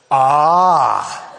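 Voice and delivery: a loud, drawn-out ah of sudden understanding; realizing ah. Retold or imitated. Flight attendant grasping who the passengers are, loud realizing ah. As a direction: let it dawn on her, out loud.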